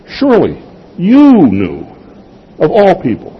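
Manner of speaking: "Surely" and "all" pitched high, "all" hit hardest; stress on "all". Shown as a demonstration. Preacher repeating the line to the kids, high stress on "all". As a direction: give "all" the weight of the line. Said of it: "putting the emphasis on the right word".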